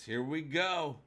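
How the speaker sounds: ordinary talk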